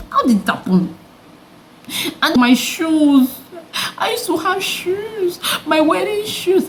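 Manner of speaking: high, slow, half-crying wail over the shoes; mock lament. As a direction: whimper it like a dramatic loss. Mourning her lost wedding shoes.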